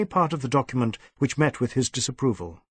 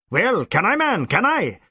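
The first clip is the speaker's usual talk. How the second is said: higher, louder insistent demand; 8 semitones higher, 4 dB louder than usual. Acted. Superintendent pressing his silent inspector, insistent and demanding. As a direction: press him for an answer.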